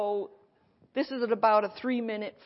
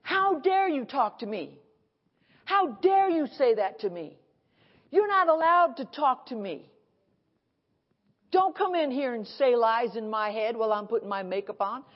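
loud, higher indignant scolding; indignant rebuke; 4 semitones higher, 5 dB louder than usual. Retold or imitated. Preacher scolding the devil while doing her makeup, indignant loud. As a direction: put the devil in his place, outraged. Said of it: "And I said".

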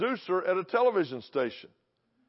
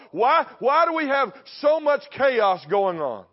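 high, loud, impassioned pulpit delivery; full-force preaching; 5 semitones higher, 6 dB louder than usual. Real, not acted. Preacher warning the church it is under attack, preaching at full force. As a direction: drive each question home to the congregation.

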